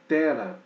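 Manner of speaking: "Tera" with stress on the first syllable, TE-ra. Shown as a demonstration. Teacher correcting students: "Tera" stressed on TE. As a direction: say "Tera" as TE-ra, not te-RA. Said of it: "não é Terá, mas Tera".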